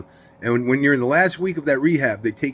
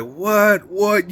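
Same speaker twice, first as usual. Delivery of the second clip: higher-pitched retold disbelief; mock incredulous; 6 semitones higher than usual. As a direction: react as if you can't believe it.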